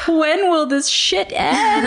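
high, slow, drawn-out despair; exasperated wail. Retold or imitated. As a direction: wail it, dragging each word. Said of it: "and I was like".

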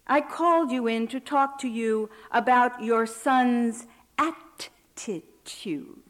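at-ti-tude spelled out slowly and patronizingly; condescending drawn-out syllables. Acted. Captain to the inmate mother, talking down slowly. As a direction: stretch attitude into three slow syllables, as to a slow child. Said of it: "pronouncing all three syllables as though to a slow child".

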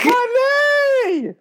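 very high, long drawn-out squeal; overwhelmed fangirl wail. Acted. Co-host reacts to the character's secret love, high squealed wail. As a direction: wail it out like a mock scream. Co-host cries out over spoiler.

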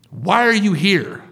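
high, slow, incredulous question; nurse's pointed demand. Retold or imitated. Nurse staring at a patient, pointed demand. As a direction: challenge him, stretching the words out. Retold nurse confronting him.

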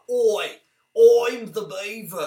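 mock Australian accent, punchy Oi; light Australian beaver voice. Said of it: "stereotypically Australian vowels".

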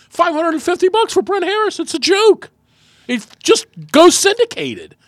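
high-pitched mock outrage; indignant outburst. Host replaying his staged rant about a colleague's pay, high-pitched indignation.

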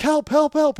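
high, loud mock call; retold cry for help. Tripper in porta potty, mock calling out.

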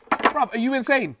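high, incredulous bruv; disbelieving outburst. Real, not acted. Mate scoffing at a friend's wild behaviour, incredulous outburst. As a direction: react like he's lost his mind.

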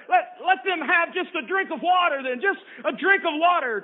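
high pleading, pushing each phrase. Acted. Sister pleading with the keeper, high pitched. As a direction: beg for just a drink.

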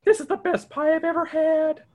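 high, delighted exclaiming; excited Shaggy. Acted. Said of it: "Shaggy exclaims".

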